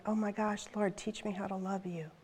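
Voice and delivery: humble, softened voice to God; hushed, earnest plea. Retold or imitated. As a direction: pray it quietly as a sudden realization. Retells her awed prayer to God.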